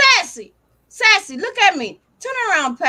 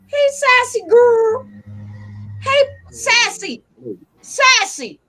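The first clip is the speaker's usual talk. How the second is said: higher, slow cooing hey; sweet dog greeting; 4 semitones higher, slower than usual. Real, not acted. Owner calling to her dog Sassy, slow high coo.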